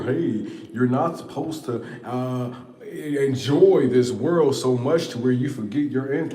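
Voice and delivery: a lightly mocking, preachy lecture voice; preachy spiritual person. Mocking the spiritual crowd, a mild preachy imitation. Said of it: "mocking spiritual advice givers".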